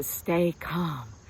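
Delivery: calm yourself with a long exhale; soothing exhale. Shown as a demonstration. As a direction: breathe out on calm. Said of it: "every time I say calm, I'm exhaling".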